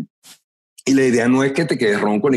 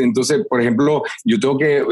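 ordinary talk

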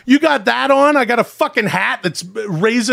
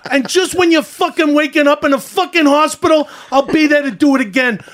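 higher, heated tough-guy threats; ranting character voice; 6 semitones higher than usual. Acted. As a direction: threaten him like a mobster in a tirade.

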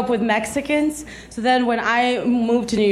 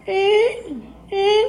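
high mock scanner buzz; short, high vocal beeps; imitated alarm beep; 8 semitones higher than usual.

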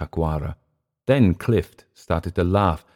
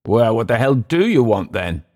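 higher, louder irritated demand; exasperated; 6 semitones higher, 5 dB louder than usual. Acted. Clift to visitors refusing his bottle, exasperated. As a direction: throw it back at the unwanted caller.

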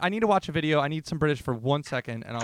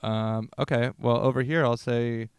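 ordinary talk

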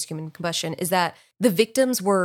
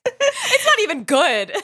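high, loud, through real laughter; laughing; 6 dB louder than usual. Real, not acted. Admitting the accent is bad, laughing. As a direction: talk while cracking up. Cracking up at own accent attempt.

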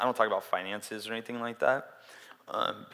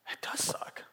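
near-whispered retold reply; whispered imitation of a frank confession; hushed admission; 3 dB quieter than usual. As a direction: let it out quietly, almost without voice. Retold quiet admission after sympathy.

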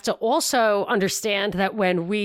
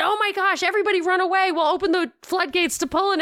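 high mock panic cry; 8 semitones higher, faster than usual. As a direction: ham up a panicked crowd. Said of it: "exactly why they say".

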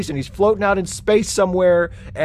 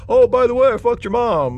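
imitating Jason voice; 8 semitones higher, 4 dB louder than usual. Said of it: "talk in his voice and fucking say shit like".

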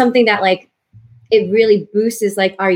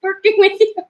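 gushing excitedly; 11 semitones higher, slower than usual. Retold or imitated. Texting co-star after recording together.

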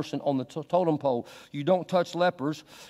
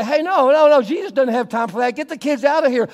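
much higher, louder mock rebuke; retold disciples turning the children away, loud; disciples shooing; 9 semitones higher, 8 dB louder than usual. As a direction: bark them away like the disciples. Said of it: "And the disciples say".

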